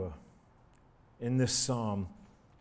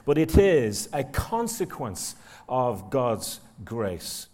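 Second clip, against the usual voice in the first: slow, lower, deliberate delivery; slow and weighty; 3 semitones lower, 4 dB louder, slower than usual. Shown as a demonstration. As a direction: drag each word out solemnly. Repeated line, slow weighty take.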